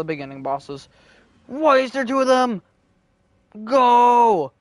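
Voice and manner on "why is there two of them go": high, loud frantic yell; panicked yell. Real, not acted. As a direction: scream it while fleeing. Two bosses chase her.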